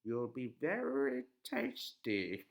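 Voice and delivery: high, loud witch threat; menacing old witch. A narrator's reading. Witch voiced.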